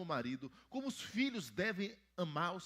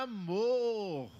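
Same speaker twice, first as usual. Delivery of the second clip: calling out; 5 semitones higher, 7 dB louder than usual. Acted. Answering own quiz to children.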